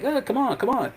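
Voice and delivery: high, teasing come-on; mocking taunt. Punk goading Rollins to come through the door, high teasing call. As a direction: goad him lightly, voice up high. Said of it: "Punk was like".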